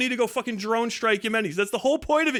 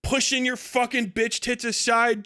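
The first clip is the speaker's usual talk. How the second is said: ordinary talk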